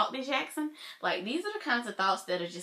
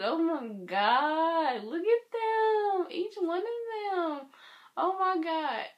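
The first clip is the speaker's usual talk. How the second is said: high, slow, gushing fan squeal; excited gushing; 10 semitones higher, slower than usual. Real, not acted. Fan gushing over each member in the photo, excitedly. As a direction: melt over every face in the picture.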